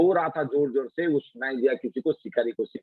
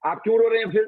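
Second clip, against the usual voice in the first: put-on high, louder challenging boy; child's question; 9 semitones higher, 5 dB louder, much faster than usual. Boy Yama challenging the weeping queens, high loud question. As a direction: fire the question back like a clever kid. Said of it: "बोलते".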